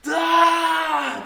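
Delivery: loud, high drawn-out "aaah"; imitated scream. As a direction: scream like a raving man heard down the street. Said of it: "And then all I hear is".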